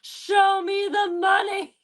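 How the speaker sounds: high, slow movie-style yell; slow, high mock yell of Show me the money. Shown as a demonstration. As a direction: belt it like the film line. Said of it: "yell it in the same tone as".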